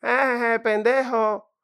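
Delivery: high, loud, slow mocking taunt; mocking cousin. Cousin mocking how he speaks Spanish, high and loud. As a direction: jeer like a cousin. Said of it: "ningún primo que lo llama".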